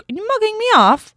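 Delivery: put-on high, loud British islander accent; mock-indignant Love Island British accent. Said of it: "saying some of their lingo".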